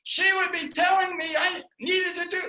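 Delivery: high, loud, strained voice; imitating the wife's high, loud orders. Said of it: "in a high voice".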